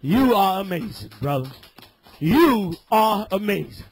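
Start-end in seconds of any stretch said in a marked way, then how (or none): none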